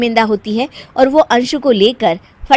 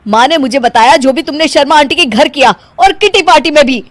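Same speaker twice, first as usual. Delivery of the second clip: stern order; 5 semitones higher, 8 dB louder than usual. Acted. Husband ordering wife out.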